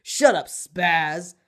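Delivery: loud, slow, drawn-out jeer; mean put-down. A narrator's reading. Tall boy shoving a rambling classmate, loud mean put-down. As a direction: shove him aside with your voice.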